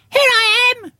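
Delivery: put-on high squeak of a small child; squeaky Roo. Little Roo calls out to Eeyore, a high squeak. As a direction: pipe it up very high and bright. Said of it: "squeaked Roo".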